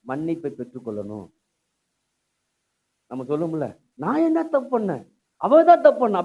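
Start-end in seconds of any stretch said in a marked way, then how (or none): 3.9-6.2 raised, higher protest in an acted retort; indignant blame-shifting. Preacher mimicking someone who blames others, indignant. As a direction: pin the blame on her, loudly.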